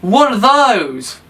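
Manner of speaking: "What are those?" yelled high then dropping; imitating Spider-Man's meme shout; loud mocking quote. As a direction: bark "What are" loudly, trail off on "those". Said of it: "loudly goes".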